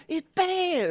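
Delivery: sing-say it way up high; high pop-star parody. Retold or imitated. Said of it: "He's like".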